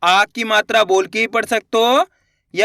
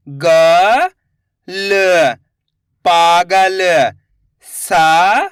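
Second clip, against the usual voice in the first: each sound called out slowly and a bit louder, then the whole word; slow syllable drill; 3 semitones lower, 4 dB louder, much slower than usual. Shown as a demonstration. Teacher sounding out letters for children, slow and clear.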